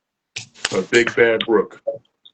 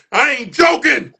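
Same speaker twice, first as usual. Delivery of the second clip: shout; 4 dB louder than usual. Acted. Poem climax shout.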